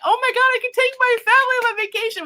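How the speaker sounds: high, thrilled squeal of disbelief; bursting with joy over the win; excited. Retold or imitated. Jackpot winner to the dealer, a high excited squeal.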